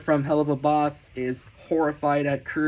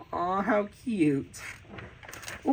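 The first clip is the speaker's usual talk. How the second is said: usual speaking voice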